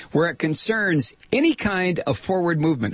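ordinary delivery

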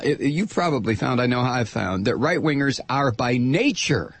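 ordinary talk, nothing marked

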